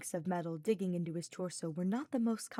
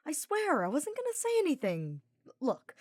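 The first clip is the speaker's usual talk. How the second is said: defensive; 9 semitones higher, 5 dB louder than usual. A narrator's reading. Sylvain defends himself.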